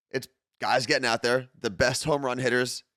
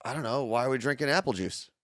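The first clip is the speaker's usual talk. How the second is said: usual speaking voice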